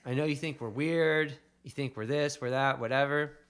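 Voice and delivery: imagined believer defending his people; defiant retort. Pretend. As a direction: snap back at people who call you weird. Imagined defiant speech of faithful people.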